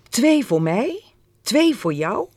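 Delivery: high, loud, delighted sharing-out; triumphant, punched-up working out; excited realisation. A narrator's reading. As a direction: deal them out with rising excitement. Boy has a happy realisation.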